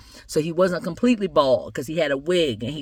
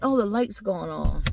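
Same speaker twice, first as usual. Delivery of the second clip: plain reading voice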